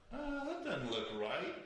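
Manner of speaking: high, drawn-out note of doubt; uneasy double take. Retold or imitated. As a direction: act out a slow, high-pitched realization. Retells reaction to seeing snake.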